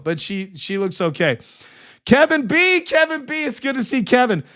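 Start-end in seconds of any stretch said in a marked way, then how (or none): 2.0-4.4 high, bright call of a regular viewer's name; excited greeting. Real, not acted.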